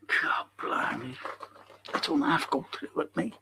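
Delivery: hushed whisper under his breath. Real, not acted. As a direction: mutter it half to himself, unvoiced. Muttering to himself after sneezing.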